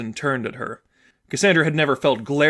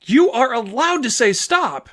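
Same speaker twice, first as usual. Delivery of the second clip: slow, higher, louder insistence; angry outburst; 11 semitones higher, 5 dB louder, slower than usual. Acted. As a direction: insist hard on every word. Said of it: "Those didn't sound like words that should have been angry".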